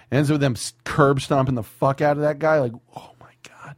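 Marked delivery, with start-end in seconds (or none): 3.0-3.7 whispered awe; whispering. Real, not acted. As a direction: breathe it out in awe. Awed whisper.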